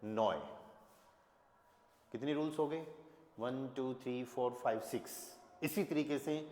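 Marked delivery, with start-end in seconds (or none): 0.0-0.4 नॉय as n plus an oy glide; oy sound. Shown as a demonstration. Teacher blending N-E-U for learners, नॉय rhyming with oy. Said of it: "तो ये क्या हो जाएगा?".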